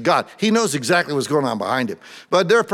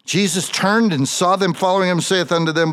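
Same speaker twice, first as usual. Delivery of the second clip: louder, higher, fervent preaching; preaching at full force; 6 semitones higher, 6 dB louder than usual. Acted. Preacher reads and retells the scene to his congregation, fervent. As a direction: preach it with rising fire to the room.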